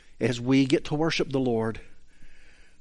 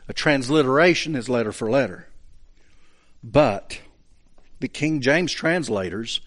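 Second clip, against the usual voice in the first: ordinary delivery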